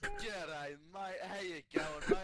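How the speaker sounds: a put-on Aussie greeting in a hammed-up Australian accent; teasing a mate with an over-the-top Australian accent. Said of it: "You sound like someone that's migrated to Australia".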